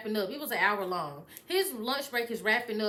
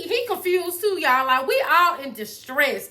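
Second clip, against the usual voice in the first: usual speaking voice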